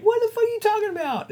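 high-pitched baffled squeal; mock incredulity. Client's incredulous reply.

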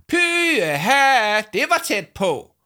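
high, drawn-out "pyha"; stretched high-pitched holler; relieved shout. A narrator's reading. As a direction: call out in huge relief on the way back. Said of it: "kunne man høre Jama råbe".

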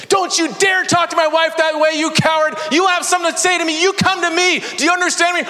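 father confronting neighbor, shouting in fury; furious confrontation. Retold or imitated. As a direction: tear into the neighbor. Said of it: "dismantled his humanity".

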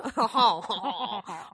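hammed-up, sneering "haw haw" laugh; cheesy French laugh. Coached by cohost on French sneer, cheesy French laugh. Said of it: "the cheesy French laugh".

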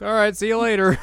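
high, loud, casual farewell; breezy high voice. Retold or imitated. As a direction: toss it off cheerfully.

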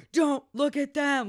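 loud, high, forceful command; urgent acted plea. Friend retells angel's plea to dying lover, urgent shout. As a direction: act out a desperate order.

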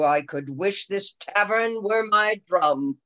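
ordinary talk, nothing marked

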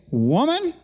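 mock-defiant "Woman" to his own mother; imagined cheeky retort to his mother. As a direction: fling "Woman" at his mother like a rebellious son. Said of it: "look at my mother and say".

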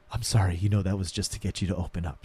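soft, low imitation of a consoling coach; gentle apology. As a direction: console her, low and quiet. Retold coach apologizing while hugging student.

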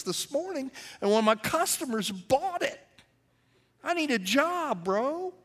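high, pleading acted quote; earnest new convert. Tavern owner telling pastor he sold up, earnestly pleading. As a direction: plead his case, pitched well up.

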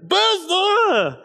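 put-on very high, loud toddler cry; toddler wail. Father imitating his toddler's tearful wail for Blue's Clues. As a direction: stretch it out like a sobbing toddler.

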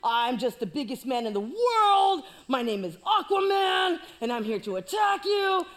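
loud bragging shout; hammed-up giant boast; boastful giant. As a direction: brag at the whole army. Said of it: "talking all this mess".

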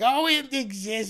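put-on a light, high, slow New York accent; a sly New York housewife in a light New York accent. The wife answers Miracle Max in a light New York accent. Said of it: "talks like she's from New York".

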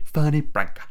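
a hint of the thick British accent; Michael Caine. Quoting the film's drink, lightly done bad British accent. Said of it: "Michael Caine impression from Dark Knight Rises".

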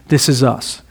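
ordinary delivery

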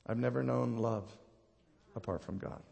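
quiet resolve. Retold or imitated. Preacher's retold answer to the enemy, quietly resolved. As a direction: say it low and humbly, with pauses.

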